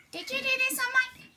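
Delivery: high squeaky mole voice; a small, put-out mole; little mole. Acted. Mole demanding who dirtied his head, high squeak. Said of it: "he was just going to ask again".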